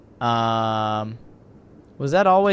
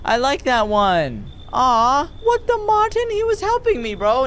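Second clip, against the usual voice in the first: high-pitched pleading whine; hammed-up heartbroken complaint; mock dismay; 8 semitones higher, slower than usual. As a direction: wail in playful protest as a favorite gets taken away. Mock dismay at agent taking him.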